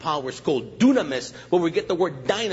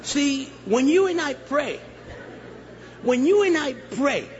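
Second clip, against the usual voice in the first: preaching; 10 semitones higher than usual. Real, not acted. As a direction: preach it at full force. Preacher building intensity.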